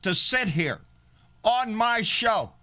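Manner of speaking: proud declaration. Real, not acted. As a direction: proclaim your rights with pride. Proud loud declaration over music.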